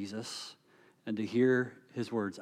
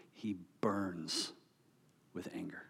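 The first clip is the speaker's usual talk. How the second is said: hushed whisper; 4 semitones lower, 10 dB quieter than usual. Real, not acted. Pastor hushing the congregation, whispered intensity. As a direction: breathe it out, unvoiced and much softer. Sermon describing Jesus' burning anger.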